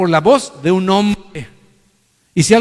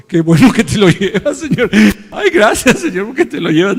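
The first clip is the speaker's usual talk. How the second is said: mock relieved crowd; 6 semitones higher, 7 dB louder than usual. Congregation joking they're glad he leaves.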